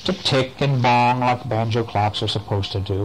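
ordinary delivery, nothing marked

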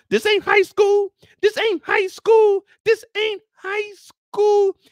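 high, loud and slowing each time; hammering high, loud, slow; slow emphatic repetition. Shown as a demonstration. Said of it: "Remember that, y'all".

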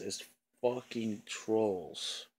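slow, drawn-out exasperation; angry emphasis. Real, not acted. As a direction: drag the words out, fed up. Angry rant about trolls.

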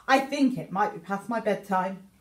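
plain reading voice

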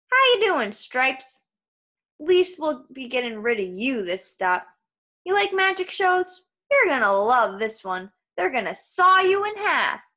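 high, loud mock-friendly jeer; lively trucker voice, swooping up high; taunting trucker. A narrator's reading. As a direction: tease a caged animal with relish.

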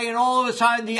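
normal talking voice

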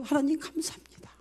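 높고 울먹이는 목소리로 기도 흉내; 감사 기도에서 작아지며 우는 듯이. Retold or imitated. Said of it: "오히려 그가 울고 내가 다 울어요". (울먹이며 감사 기도하듯)

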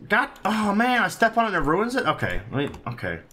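ordinary talk, nothing marked

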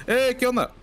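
sudden high startled cry; startled yelp. Real, not acted. Streamer startled by an enemy ambush, high yelp. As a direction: jump at the sudden attack. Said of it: "Me, me asusté".

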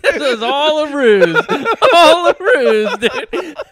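loud, high, drawn-out words through laughter; helpless belly-laugh delight, slow and high; real uproarious laughing. Real, not acted. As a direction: howl it while cracking up. Cracking up at the kid's punchline.

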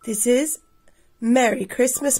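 ordinary delivery

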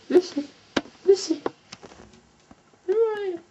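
high calling voice. Real, not acted. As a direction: call the dog from afar. Calling the dog.

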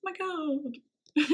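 awed gasp. Retold or imitated. Moved retelling her reaction.

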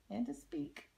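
ordinary delivery

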